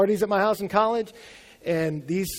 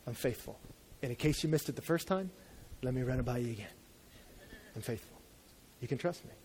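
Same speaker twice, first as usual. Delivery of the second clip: much softer, lower, gentle promise; soft tender divine promise; God reassuring; 6 semitones lower, 13 dB quieter, faster than usual. Pretend. Voicing God's reassurance.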